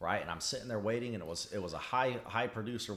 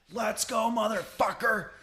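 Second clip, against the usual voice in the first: much higher, louder fighting yell; challenging shout; 13 semitones higher, 6 dB louder, slower than usual. Retold or imitated. Trucker dares the yelling driver to fight, shouting. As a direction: shout a dare at the angry driver. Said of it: "waving a pipe wrench at him".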